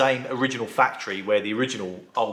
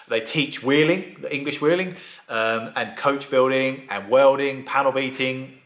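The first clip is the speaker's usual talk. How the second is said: ordinary talk, nothing marked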